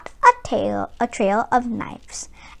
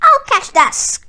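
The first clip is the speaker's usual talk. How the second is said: high, loud furious roar; 6 semitones higher, 4 dB louder, much slower than usual. Acted. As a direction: bellow the villain's name. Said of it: "roared Barkus".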